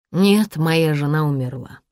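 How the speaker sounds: usual speaking voice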